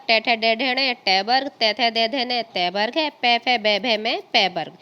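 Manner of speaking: each consonant row chanted out clearly; recited consonant rows. Shown as a demonstration. Teacher chanting the consonant rows for children to repeat. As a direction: sound out the stops row by row for the class. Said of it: "ये हैं आपके पांच पांच".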